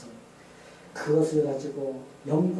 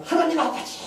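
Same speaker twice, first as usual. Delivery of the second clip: high, loud anguished call; crying out to God; 10 dB louder than usual. Retold or imitated. Retelling a heartbroken man under the night sky, crying out to God. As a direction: shout up to heaven as if heartbroken. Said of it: "막 소리 지르면서".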